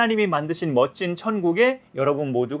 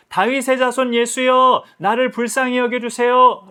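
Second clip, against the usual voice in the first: crying out; 7 semitones higher, 5 dB louder than usual. Acted. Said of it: "소리를 지르니까". (높고 크게 천천히 외치는 간청)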